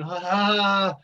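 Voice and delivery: imitating locals' pointed laughter; mock laugh. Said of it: "pointing at me and going".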